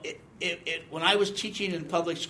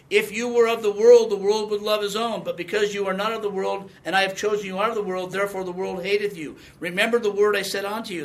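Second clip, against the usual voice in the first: louder, solemn preaching read of the verse; scripture reading; 2 semitones higher, 4 dB louder than usual. Acted. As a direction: proclaim it from the pulpit. Reads scripture aloud in sermon.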